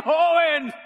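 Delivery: loud, high-pitched shout; mock crowd shout. Forty-year-olds declare themselves young, mock shout. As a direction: shout it out like a proud chorus.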